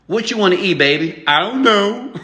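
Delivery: high, hesitant voices for the uncertain pair; mock indecisive couple. Mocking a couple who can't pick where to eat, high and unsure.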